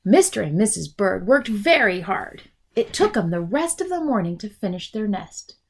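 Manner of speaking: ordinary delivery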